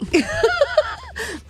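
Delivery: real high cackle; high laugh. Real, not acted. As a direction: cackle at the memory.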